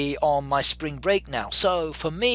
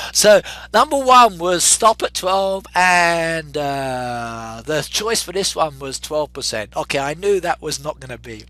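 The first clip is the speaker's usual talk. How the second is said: usual speaking voice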